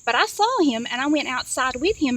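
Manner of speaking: usual speaking voice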